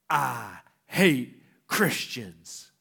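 slow, low snarl; slow, drawn-out mock hostility of a retold confrontation. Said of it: "like, a snarl on his face".

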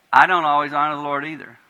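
ordinary delivery

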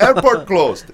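high, loud mock Indian announcer; imitating Indian accent. Announcer tells waiting crowd, high loud Indian accent mimicry. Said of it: "she gets on, she says".

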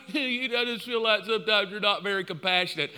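softly imitating a wife's complaint; a hint of a hurt wife; lightly voiced wife. Wife telling husband he lacks compassion, lightly imitated. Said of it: "She's like".

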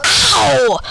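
loud, high yelp; acted cry of pain; screamed in pain. A narrator's reading. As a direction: yell it out as if hurt. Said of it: "I screamed".

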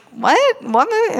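high, rising baffled questions; puzzled. Retold or imitated. Teacher reacting to client's movie clips, comic bafflement.

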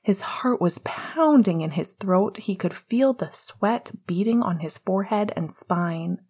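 usual speaking voice

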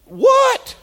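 very high, loud incredulous "What?"; preacher feigning shock at the congregation; mock shock. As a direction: squeal it in disbelief.

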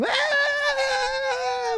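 very high, long bawling scream; mock child's tantrum wail. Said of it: "screaming and crying like a big child".

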